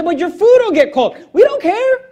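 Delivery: defiant dismissal. Acted. Preacher answers worry about food getting cold, defiantly. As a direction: wave the objection away, voice leaping high.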